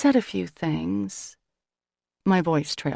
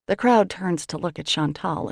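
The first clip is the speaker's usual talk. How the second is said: plain reading voice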